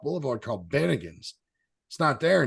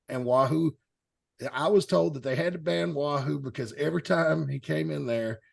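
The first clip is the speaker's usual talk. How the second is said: usual speaking voice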